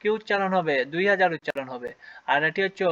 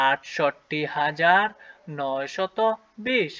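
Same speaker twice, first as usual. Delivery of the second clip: slow careful; much slower than usual. Shown as a demonstration. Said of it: "তাহলে উচ্চারণ কি হলো?".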